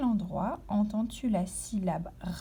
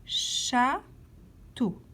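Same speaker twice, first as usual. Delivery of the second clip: "Château" split in two with a clear pause; syllable by syllable. Shown as a demonstration. Teacher letting pupils locate a sound, "Château" syllable by syllable. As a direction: stretch out "Château", pausing between its two syllables. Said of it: "Colorie la case où tu entends to".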